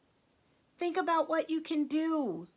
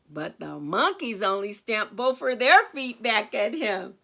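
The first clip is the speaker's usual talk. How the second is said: ordinary delivery, nothing marked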